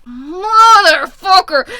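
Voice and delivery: loud, high, drawn-out curse; exasperated yell. Real, not acted. Reader cursing the love triangle, drawn-out frustrated yell. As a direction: wail it slowly at full volume.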